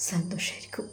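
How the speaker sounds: breathless whisper trailing off; whispered thought. Acted. Sinan wishing his love happiness, whispering to himself. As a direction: let the last word fade to a whisper.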